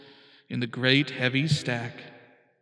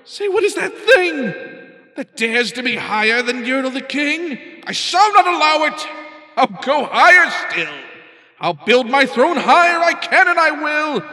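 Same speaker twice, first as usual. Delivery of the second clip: much higher, louder furious vow; outraged king; 10 semitones higher, 9 dB louder than usual. Acted. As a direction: rage at the rising moon.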